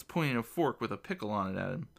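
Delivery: plain reading voice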